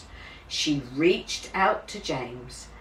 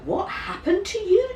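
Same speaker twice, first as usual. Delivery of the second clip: jolly nurse. A narrator's reading. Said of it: "said the nurse".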